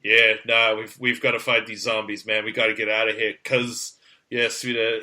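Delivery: put-on low-pitched Kiwi-American hybrid accent; American-Kiwi mash. Actor rallies zombie fighters, American-Kiwi mash. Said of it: "mash between American and Kiwi".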